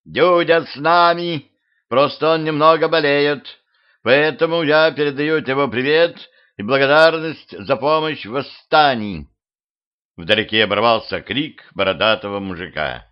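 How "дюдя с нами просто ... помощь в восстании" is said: thin, raised voice of a female ruler. A narrator's reading. Ruler speaks into the hushed square, thin high voice. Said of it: "раздался тонкий голос повелительницы".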